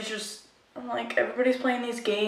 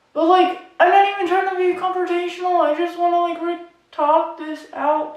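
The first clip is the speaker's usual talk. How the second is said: exasperated; 9 semitones higher, 5 dB louder than usual. Real, not acted. Exasperated complaint about guy.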